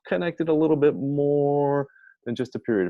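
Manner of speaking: high, loud voice for a chatty punctuation mark; voicing the semicolon. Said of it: "It says".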